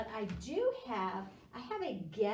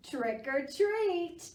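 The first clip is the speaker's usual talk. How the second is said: slow, high, lilting cheer; sing-song greeting; 10 semitones higher, 7 dB louder, slower than usual. Acted. Teacher singing happy Halloween to kids. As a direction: sing it out festively.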